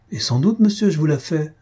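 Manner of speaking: high-pitched impatient retort. A narrator's reading. Countess answering the baron's insolent question, impatient. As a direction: snap back in rising impatience. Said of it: "s'écria Faustine avec impatience".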